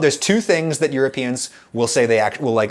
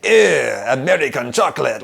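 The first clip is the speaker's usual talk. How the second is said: mock French accent, sniffy and louder; snobby John Cleese Frenchman; 3 semitones higher, 6 dB louder than usual. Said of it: "I don't know what accent that was".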